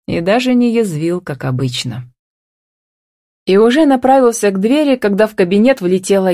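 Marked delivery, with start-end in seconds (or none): none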